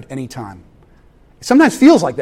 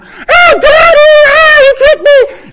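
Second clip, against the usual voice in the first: put-on much higher, louder child's wail at daddy; imitated kids screaming; 13 dB louder, slower than usual. Dad mimics his kids screaming at him from the living room, high laughing wail. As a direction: squeal like a kid tattling through laughter. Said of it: "I hear the kids screaming".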